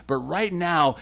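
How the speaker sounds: ordinary talk, nothing marked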